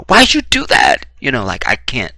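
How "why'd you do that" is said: very high, loud pained cry. Acted. Shot man to the shooter, cry in pain. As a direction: squeal it out as if just shot. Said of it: "it would be in pain though".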